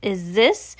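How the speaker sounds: slow, scornful witch voice; Grand High Witch scoffing, slow and harsh; scornful shout. Acted. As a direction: scoff at the nonsense slowly. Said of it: "shouted the Grand High Witch".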